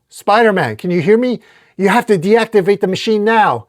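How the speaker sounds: high, loud desperate order; pressing, shouted command; urgent calling out. Acted. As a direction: call across the wreckage to a trapped ally. Superman calling to trapped Spider-Man.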